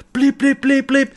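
high, even imitated beeps; loud bomb bleeps. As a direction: mimic an alarm bleeping urgently. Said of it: "the bomb is bleeping very loudly".

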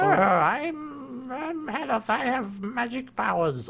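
high wavering wizard voice; doddering old wizard; old man voice. Retold or imitated. Continues old wizard impression.

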